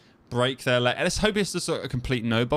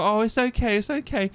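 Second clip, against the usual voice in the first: soothing; 12 semitones higher than usual. Retold or imitated. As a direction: hush a crying toddler gently. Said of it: "And I was like".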